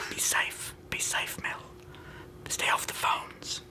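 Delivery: soft, slow whisper of advice. Pretend. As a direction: whisper it to Mel like a secret.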